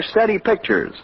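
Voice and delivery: ordinary talk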